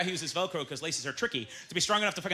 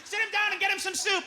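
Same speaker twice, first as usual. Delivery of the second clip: fussing parent; 11 semitones higher than usual. Acted. Caring family.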